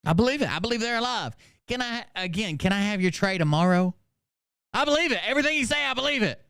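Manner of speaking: high, fawning mock voice; butter her up to get the tray; mock sucking-up inmate. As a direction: podcaster mocks inmate agreeing for food. Mocking inmate sucking up for food.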